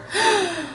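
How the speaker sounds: sharp gasp, pitched high; high startled gasp. A narrator's reading. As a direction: gasp in surprise at the mirror. Kitten sees himself in mirror.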